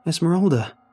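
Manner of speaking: loud, high call for help; shouted. A narrator's reading. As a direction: cry out the name urgently. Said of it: "I shouted".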